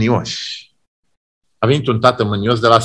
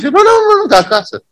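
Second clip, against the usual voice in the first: mocking parents; 3 dB louder, faster than usual.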